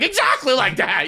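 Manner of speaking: high, thrilled exactly; excited Michael. Retold or imitated. Seatmate thrilled that the storyteller finally gets it, high and eager. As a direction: bounce it up with delight. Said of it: "He gets so excited every time".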